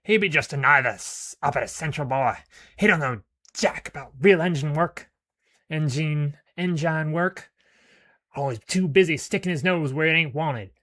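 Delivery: Skinny scorning Olaf's engine knowledge; sneering thug. Acted. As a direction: scoff at Olaf like a crude pirate. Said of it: "Skinny sneers".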